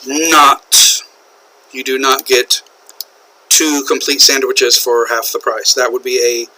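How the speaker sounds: ordinary talk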